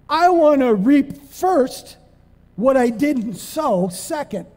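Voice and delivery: high, slow, drawn-out mock whine; mocking selfish voice. Preacher mocking a selfish believer, slow whine. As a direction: stretch it out like a spoiled child. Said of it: "This is what they're saying".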